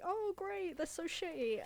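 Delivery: high-pitched sarcastic moan; hammed-up complaint, pitched well up; mock dismay. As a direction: imitate someone whining about unwelcome relatives. Dismay at family showing up.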